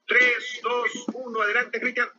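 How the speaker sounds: high-pitched moderator's countdown; countdown call. Real, not acted.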